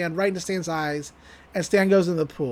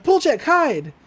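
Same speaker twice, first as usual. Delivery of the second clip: mock alarm; 8 semitones higher, 3 dB louder, slower than usual. Acting out a panicked warning.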